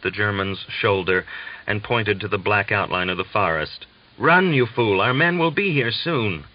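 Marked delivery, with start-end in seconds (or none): 4.2-6.4 a Russian soldier urging the German to run, urgently. Acted. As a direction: hurry him away, raised voice.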